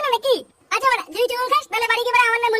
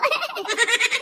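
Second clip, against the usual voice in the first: mocking laugh; 4 dB quieter than usual. Real, not acted. Friend mocks the boast.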